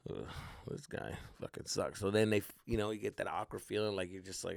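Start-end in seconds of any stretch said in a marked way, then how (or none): 0.1-2.0 low-volume, voiceless grumble; muttered aside. Acted. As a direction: mutter it under your breath, soft and low. Muttered aside behind insincere reassurance.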